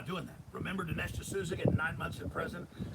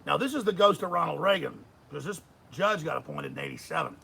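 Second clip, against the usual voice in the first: ordinary talk, nothing marked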